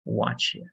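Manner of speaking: "what you" run together as "whatcha"; linked reduced form. Shown as a demonstration. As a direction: say "what you" as one word, WUH-chuh. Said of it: "some examples of linking".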